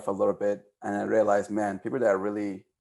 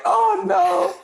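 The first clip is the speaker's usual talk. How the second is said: high, louder squeak of dread; mock dread; 5 dB louder than usual. Boy hears his brother's threat, squeaks in mock dread. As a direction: squeal it like a kid who knows he's in trouble. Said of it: "I was like".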